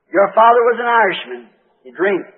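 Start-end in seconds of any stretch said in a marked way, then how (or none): none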